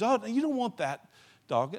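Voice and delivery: high-pitched mild dissuading, as a shop owner; pet shop owner. Retold or imitated. Shop owner talks a little boy out of the lame puppy, lightly acted and high. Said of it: "then the owner says".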